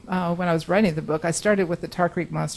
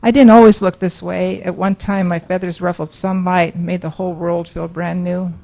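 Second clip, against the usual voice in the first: louder, measured poetry recital, phrase by phrase; poem reading; 8 dB louder than usual. Acted. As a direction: read the monster's poem out to the room. Reads persona poem aloud.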